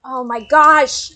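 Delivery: sudden loud high yelp of fright; jump-scared, blurt it out; startled shout. Real, not acted. Jump scare in horror game.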